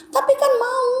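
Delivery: high child's whine, imitating; mock a pleading child's voice; small girl begging, pitched high; whiny child. Child whining, retold.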